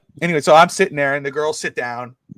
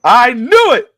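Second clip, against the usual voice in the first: high, loud burst of triumph; loud vindication; 10 semitones higher, 10 dB louder, much slower than usual. Retold or imitated. Dad repeating himself to his daughter, high triumphant shout. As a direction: blurt it out at full volume.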